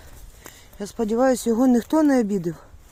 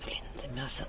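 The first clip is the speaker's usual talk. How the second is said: whispered aside, much softer; 7 semitones lower, 13 dB quieter than usual. Real, not acted. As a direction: whisper it to yourself. Muttering aside while feeding. (прошепотіти собі під ніс)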